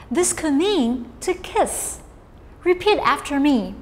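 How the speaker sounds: normal talking voice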